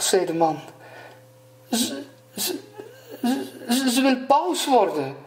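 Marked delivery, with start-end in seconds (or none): 1.7-5.2 high, hesitant, stuttering voice; timid stammer. A narrator's reading. Scared husband telling the fish his wife's wish, timid stammer.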